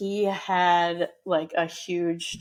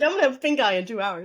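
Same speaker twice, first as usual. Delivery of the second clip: laughing; 4 semitones higher, 3 dB louder, faster than usual. Real, not acted. Joking with co-hosts.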